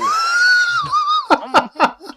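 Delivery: shrieking laugh; loud high laughing shout. Real, not acted. Big laugh after joke.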